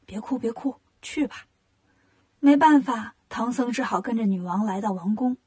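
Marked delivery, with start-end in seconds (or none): 0.1-1.4 soft soothing murmur; coaxing. Acted. Wukong softly coaxing the crying Tang Seng to go. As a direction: gently hush a crying friend. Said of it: "悟空哄他".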